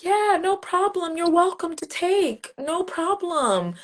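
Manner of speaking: high, slow, carefree no problem; lightly voiced carefree giver; easygoing giver. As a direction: hand it all over without a care. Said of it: "African countries".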